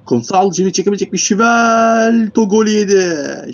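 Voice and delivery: high, loud shout, stretching out the goal; excited goal call. Real, not acted. Commentator calling a conceded goal to viewers, excited.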